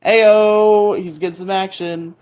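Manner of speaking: loud, high hype shout; a loud, high teasing ayo; hype call. Real, not acted. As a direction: cheer him on loudly. Cheering Billy's kiss.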